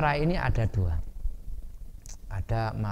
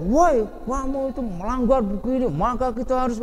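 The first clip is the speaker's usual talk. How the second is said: high, loud furious telling-off; hammed-up angry scolding; raging shout; 12 semitones higher, 5 dB louder than usual. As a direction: rant at someone who broke the rules. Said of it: "koyo ngamuk, banter".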